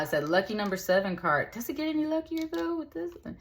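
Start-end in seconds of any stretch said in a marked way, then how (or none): 1.5-3.4 high, rising with suspense; excited. Real, not acted. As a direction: lift the voice way up in anticipation. Excited tarot reveal.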